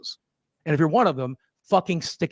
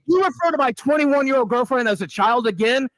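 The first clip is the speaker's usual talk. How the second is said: raised, pitched-up angry challenge; angry confrontation; 9 semitones higher, 4 dB louder than usual. Real, not acted. Defending his girlfriend to the critic, angry and raised. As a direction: confront him furiously. Angry reply to being insulted.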